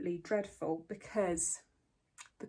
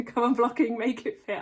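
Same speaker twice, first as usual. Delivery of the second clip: loud laughing plea; 3 semitones higher, 10 dB louder than usual. Acted. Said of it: "pleading with the knitting".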